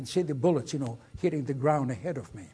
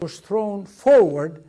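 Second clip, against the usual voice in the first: much louder, slow, punching forward; emphatic retelling; 3 semitones higher, 11 dB louder than usual. Retold or imitated. Veteran retells being thrown from the plane, loud and emphatic. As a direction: belt out forward as the jolt hits. Restarted phrase, now with forward.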